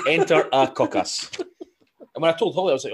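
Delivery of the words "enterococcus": slow, loud, laughing pronunciation; drawn-out word. Retold or imitated. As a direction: stretch it out through a laugh. Writing it down as podcast content.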